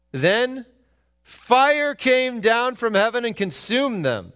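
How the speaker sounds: fiery scripture reading; scripture read aloud. Acted.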